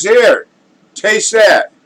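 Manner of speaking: a barfly shouting his offer; drunk fella. Acted. Drunk to connoisseur down the bar, high loud rowdy call. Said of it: "this drunk fella".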